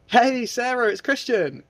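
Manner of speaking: high, loud, cheerful hello; friendly greeting. Shown as a demonstration. Tutor calling a lead, cheerful. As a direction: greet her like an old friend. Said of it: "as if you're talking to your best friend".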